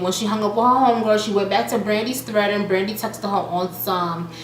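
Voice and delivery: ordinary talk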